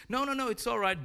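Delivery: high-pitched, airy reassurance; breezy sister. Acted. Sally to Grandma, getting out of the dishes, breezily reassuring. Sally cheerily volunteers her brother.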